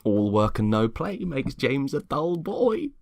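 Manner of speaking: a sweetly nosy suburban wife, pitched high; chirpy neighbour Lexi. Acted. Lexi chiding James for skipping the party, high and sugary.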